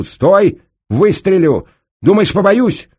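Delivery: high, loud armed threat; convincing shout. A narrator's reading. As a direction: shout it so they back off. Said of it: "Вот как убедительно она крикнула". (убедительный угрожающий крик)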